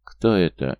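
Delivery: ordinary delivery, nothing marked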